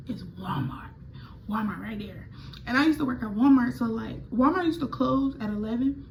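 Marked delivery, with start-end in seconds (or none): 0.1-2.3 soft unvoiced whisper; hushed whisper, much softer; relieved whisper. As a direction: breathe it out as you spot safety. Spotting refuge while hiding.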